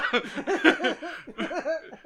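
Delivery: high breathless laughter; can't stop laughing; laughing hard. Real, not acted. Still laughing at title.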